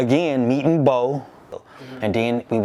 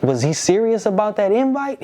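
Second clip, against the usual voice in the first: nervous self; 9 semitones higher than usual. Retold or imitated. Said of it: "I said".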